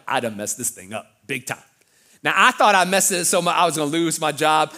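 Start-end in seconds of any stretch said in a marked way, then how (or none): 0.1-1.6 dejected mutter. Retold or imitated. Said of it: "I'm like".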